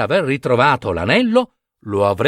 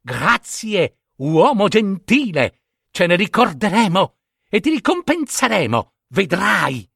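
ant king calling; 6 semitones higher than usual. Acted. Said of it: "gridò".

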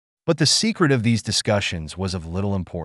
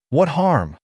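higher-pitched exclamation; indignant cry; 6 semitones higher, 4 dB louder than usual. A narrator's reading. Brass cries back at Sally's question, indignant. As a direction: throw the question back, crying out. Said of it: "cried Brass".

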